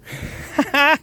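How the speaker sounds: high-pitched laugh burst; high giggle. Real, not acted. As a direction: cackle high at himself. Laughs at the tripping.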